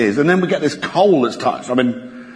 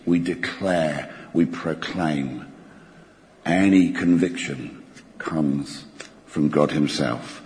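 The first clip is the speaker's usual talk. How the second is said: solemn preaching; 9 semitones lower, 5 dB quieter, slower than usual. Acted. Slow emphatic sermon climax.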